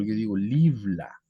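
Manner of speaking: "libla" said slowly and clearly; teaching pronunciation. Shown as a demonstration. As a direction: spell out "libla" for the class, syllable by syllable. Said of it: "para decir el libro". (pronunciación lenta de "libla")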